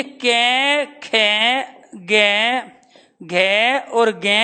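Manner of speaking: each letter spelled out slowly, one by one; drawn-out classroom recitation of the letters; slow syllable recitation. Shown as a demonstration. Teacher slowly reciting the five throat letters to students. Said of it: "कै वर्ग में आप पढ़ चुके हो".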